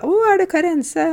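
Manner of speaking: high, excited gasp of recognition; a fan's thrilled high question; surprised recognition. Retold or imitated. Surprised fan recognizing her.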